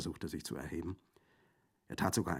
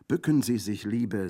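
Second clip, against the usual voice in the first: loud, higher call to duck; urgent warning; 4 semitones higher, 9 dB louder than usual. Acted. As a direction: warn her to get down. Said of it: "sagte sie zu ihrer Gefährtin".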